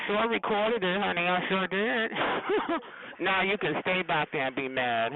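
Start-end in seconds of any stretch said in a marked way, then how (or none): 2.2-3.1 breathy laugh. Real, not acted. Gloating after recording caller.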